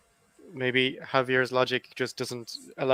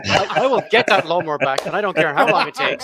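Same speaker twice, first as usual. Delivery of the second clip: louder, laughing through a mock threat; laughing mock rant; 7 semitones higher, 10 dB louder than usual. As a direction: laugh while you play the vengeful neighbour. Mock vengeful rant about neighbours.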